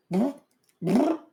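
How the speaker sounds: high, loud rolled "brr"; high trilled creature noise. Acted. Crew greets a little blue dragon, high loud trill. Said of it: "Crew will look at it and go".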